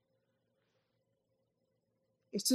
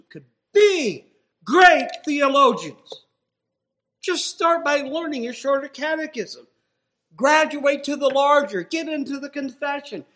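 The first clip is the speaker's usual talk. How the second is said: loud, higher fiery sermon; full-force preaching; 9 semitones higher, 12 dB louder than usual. Acted. Preacher urging listeners to study the catechism. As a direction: rouse the whole room.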